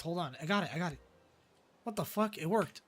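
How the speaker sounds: normal talking voice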